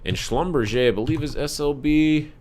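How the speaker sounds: ordinary delivery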